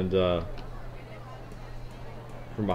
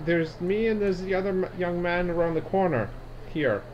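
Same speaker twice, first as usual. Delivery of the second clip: put-on high, rambling old lady; old woman; 8 semitones higher than usual. Old woman explaining her neighbours, high and rambling. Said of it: "She says".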